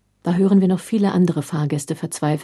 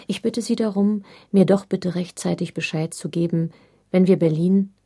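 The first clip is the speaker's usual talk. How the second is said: plain reading voice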